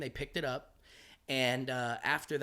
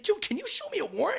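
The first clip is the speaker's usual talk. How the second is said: much higher, louder disbelieving voice; mock incredulous squeak; 7 dB louder than usual. Comic tells sergeant at his door, high squeaky disbelief.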